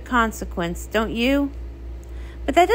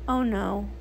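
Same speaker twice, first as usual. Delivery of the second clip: usual speaking voice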